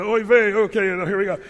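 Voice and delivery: playfully sighing Abraham, hamming up the haggling. Retold or imitated. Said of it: "Abraham's gonna".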